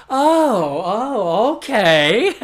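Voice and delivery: loud, high "oh, oh, okay"; big exaggerated relieved exclamation; mock relief. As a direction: play the panel's huge relief, hammed up. Casting team's relieved reaction, acted out.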